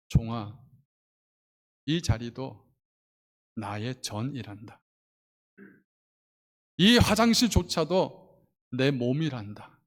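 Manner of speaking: 낮고 느리게 다정한 하나님 목소리; 낮게 가라앉혀 천천히 타이르듯; 하나님의 음성. Acted. 성령님의 감동을 전함. (조용히 깨우쳐 주는 낮은 음성)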